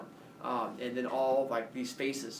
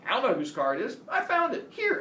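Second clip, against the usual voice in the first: high, loud protest of innocence; feigned innocence; 9 semitones higher, 5 dB louder than usual. Pretend. Student disowning his car to cops, feigned innocence. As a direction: deny it all, voice shooting up.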